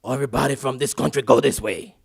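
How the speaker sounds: loud, commanding officer voice; airport security order. Retold or imitated. Port-of-entry security officer orders arrivals aside, loudly commanding. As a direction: bark the order at the arriving crowd. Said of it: "They're like".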